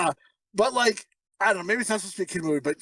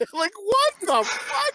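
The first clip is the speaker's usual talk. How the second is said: incredulous; 11 semitones higher, slower than usual. Real, not acted. Reacting to the lyric.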